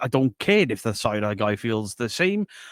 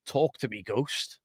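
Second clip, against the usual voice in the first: hushed, much softer spooky call; ghost voice; 8 dB quieter than usual. Pretend. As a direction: summon a spirit in a hushed voice. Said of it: "ghost voice".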